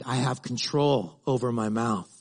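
ordinary talk, nothing marked

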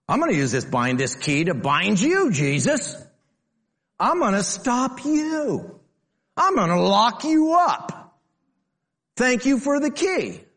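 high, slow mock Peter locking Jesus up. Preacher mocking Peter to congregation, high hammed-up shout. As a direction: ham up Peter as jailer, rising to a shout.

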